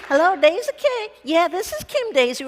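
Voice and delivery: put-on Southern accent. Staffers answering Daisy's phone line, Southern accent. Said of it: "could do a Southern accent".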